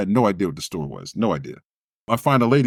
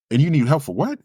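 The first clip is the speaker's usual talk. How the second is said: lady on phone; 6 semitones higher, 4 dB louder than usual. Retold or imitated. Famous lady voiced on phone.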